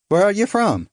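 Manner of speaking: high, lilting Irish question. Acted. Irishman probing a supposed countryman, light Irish lilt. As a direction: ask it with a bright Irish lilt. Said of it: "I couldn't place his accent".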